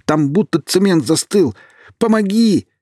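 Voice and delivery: high, loud desperate cry for help; panicked plea. A narrator's reading. Sinking Kirill begging Alina for help, panicked. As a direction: scream for help, terrified. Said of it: "услышала страх в голосе Кирилла".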